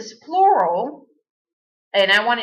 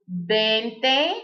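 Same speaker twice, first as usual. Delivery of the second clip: each number drawn out with a rising end; slow, deliberate counting for learners; counting slowly with a rise on every number; slow drilled counting; slower than usual. Shown as a demonstration. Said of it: "all you do is add those two".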